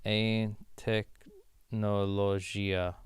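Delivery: very slow, broken into syllables; drawn-out, careful learner's Portuguese; halting Portuguese attempt. Real, not acted. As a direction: sound out the foreign word piece by piece. Sounding out the Portuguese sentence.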